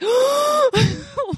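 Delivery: a loud high shocked gasp; sharp gasp. Retold or imitated. Seeing her hacked plum tree, a loud shocked gasp. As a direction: suck in air as if stopped cold.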